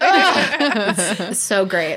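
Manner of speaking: high giggle melting into slow gushing; swooning laugh. Real, not acted. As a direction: laugh through it, drawing the words out. Gushing about the ending.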